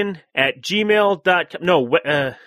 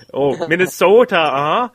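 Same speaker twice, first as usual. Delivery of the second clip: put-on louder, laughing Minnesotan accent on Minnesota; mock Minnesota accent; 4 dB louder than usual. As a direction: tease the long Minnesota vowels. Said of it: "I tried not to say it like that".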